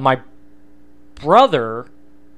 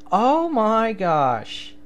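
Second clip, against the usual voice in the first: slow, drawn-out, higher gasp of disbelief; stunned; 7 semitones higher, much slower than usual. Real, not acted. Host realising his on-air mistake, slow and stunned. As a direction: let the shock sink in slowly.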